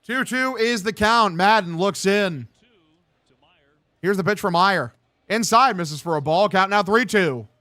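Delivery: slow, drawn-out broadcaster call; measured baseball announcer; play-by-play. Acted. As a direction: build tension on the full count.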